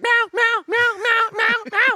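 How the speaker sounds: loud, high meowing cat; shrill falsetto cat begging for tuna. Pretend. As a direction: meow like a hungry cat begging. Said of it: "your cat's like".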